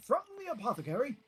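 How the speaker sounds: high-pitched, sing-song parody of the phrase; mock high-voiced echo; playful echo. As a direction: echo it high with a swooping tune. Playfully echoing another player's phrase.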